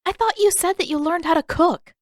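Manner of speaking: a doubtful sister poking at him; Katara's voice. Acted.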